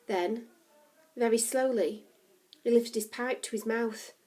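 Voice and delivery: usual speaking voice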